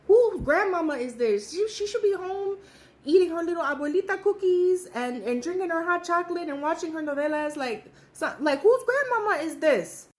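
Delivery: can you believe this, half-joking outrage; disbelieving rant. Retold or imitated. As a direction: dancer mock-fretting to her friend about the old lady. Disbelieving rant about the old dancer.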